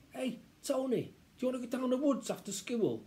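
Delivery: put-on high-pitched kid calling a pal; eager boy. Child asks Tony down the woods, eager. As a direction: invite a friend hopefully.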